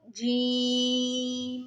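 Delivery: जीम drawn out long again; held long जीम; long jeem. Shown as a demonstration. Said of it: "जीम नहीं करना इसको". (जीम लंबा)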